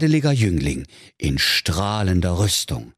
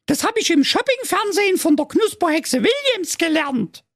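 very high, squeaky princess in Saxon; shrill-voiced spoilt princess, much higher. A narrator's reading. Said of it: "die Prinzessin erwiderte".

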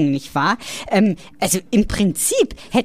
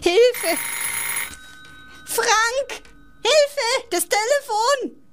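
panicked calling out; 12 semitones higher, much slower than usual. Acted. Old woman panics at ringing phone.

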